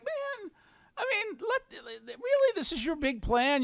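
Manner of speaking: high, pained disbelief; incredulous. Retold or imitated. Retelling the bosses' cold plan, high pained disbelief. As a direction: cry out in mock despair at the plan. Outraged retelling of own reaction.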